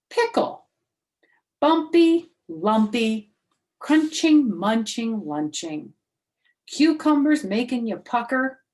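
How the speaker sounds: high, loud, bouncy poem read; reading a silly poem aloud to the class. A narrator's reading. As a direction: recite it with relish.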